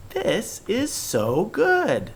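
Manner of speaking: high, slow, thrilled; excited. Shown as a demonstration. Teacher gushing to show an exclamation, excited. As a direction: sing out the pleasure. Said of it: "you can hear how excited I am".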